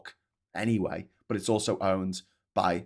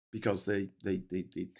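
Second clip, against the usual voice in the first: ordinary delivery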